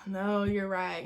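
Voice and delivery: meek agreement. Retold or imitated. Student backs down to head of class, meek and soft. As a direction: concede quietly to authority.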